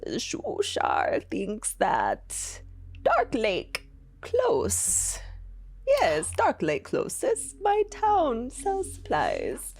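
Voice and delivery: slow, bubbly fish-man voice; blubbing creature voice. Acted. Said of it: "a blubbing voice".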